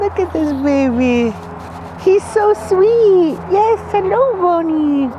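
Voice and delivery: high, loud, slow cooing. Real, not acted. Owner greets the new puppy, high cooing baby talk.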